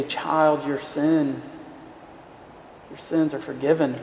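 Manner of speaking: soft, gentle voice of Jesus; hushed and tender, like a blessing; tender forgiveness. Acted. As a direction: speak forgiveness softly to a child.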